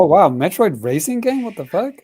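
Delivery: loud, high-pitched disbelieving outburst; retold astonishment. Reliving first sight of a racing game, astonished. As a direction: replay a baffled double take, voice jumping up. Retelling his startled first reaction.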